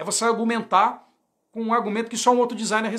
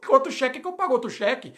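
excited client; 10 semitones higher than usual. Retold or imitated. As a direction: play the client who is sold and happy to pay. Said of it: "O cara fala".

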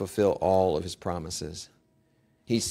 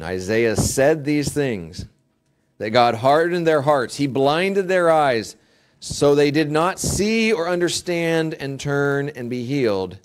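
scripture reading; 5 semitones higher, 6 dB louder than usual. Acted.

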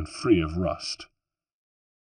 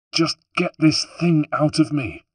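higher, with a wounded snarl; snarling in pain; 8 semitones higher, 4 dB louder than usual. Acted. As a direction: spit it out, hurting and furious. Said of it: "snarled Rosa".

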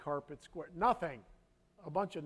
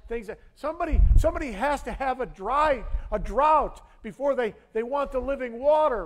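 loud, high, driving sermon voice; full-force preaching; 7 semitones higher, 10 dB louder than usual. Acted. Pastor preaching to his congregation with full force. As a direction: preach it hard to the room.

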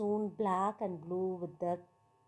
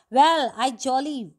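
put-on boy's firm vow; 4 semitones higher, 10 dB louder than usual. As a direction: say it like a solemn vow. Hari vows to stop pinching.

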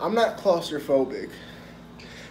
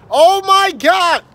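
surprised shriek; 12 dB louder, slower than usual. Real, not acted. Sudden surprise from cousin.

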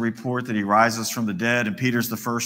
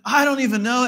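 high, loud, emphatic denial; sworn denial; 10 semitones higher, 6 dB louder than usual. Acted. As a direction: swear it with raised pitch and volume. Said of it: "he swears, he calls an oath down".

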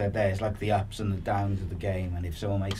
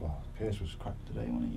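much softer, lightly muttered moan; muttered fan gossip; 8 dB quieter than usual. Retold or imitated. Fans gossiping at the front of the bus about the player, mild soft mutter. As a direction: grumble it under your breath like a fan on the coach. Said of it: "you can hear 'em at the front of the bus".

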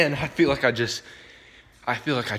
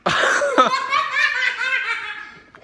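very high strained laugh; high pained cackle. Real, not acted. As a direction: cackle through the heat. After eating hot pepper.

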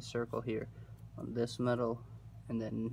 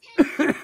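short loud asthma cough; harsh cough; 12 dB louder than usual. Real, not acted. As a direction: hack out one sharp cough. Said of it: "having some asthma today".

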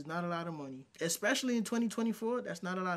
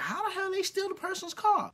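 high-pitched mocking imitation; incredulous objectors; 11 semitones higher than usual. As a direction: mimic disbelief in a much higher voice. Mimics incredulous commenters.

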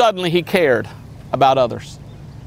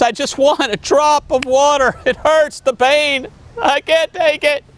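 higher, pained plea of a man in torment; anguished rich man; 9 semitones higher than usual. Acted. Rich man in hell begs Abraham for water, anguished pleading. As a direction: cry it out like he's burning.

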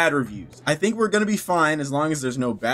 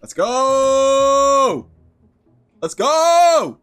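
loud, high hype shout; pumped-up battle cry; excited cheer; 8 semitones higher, 6 dB louder, much slower than usual. Real, not acted. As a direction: celebrate the cutscene starting. Cutscene starts, streamer hyped.

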